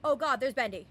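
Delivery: loud startled outburst. Real, not acted. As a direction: blurt it out in sudden alarm. Startled by Bendy appearing in game.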